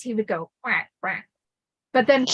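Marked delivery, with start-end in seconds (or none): 0.6-1.3 two short, understated quacks; small quack. Retold or imitated. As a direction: quack sparingly, spaced out. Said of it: "like, the small coins".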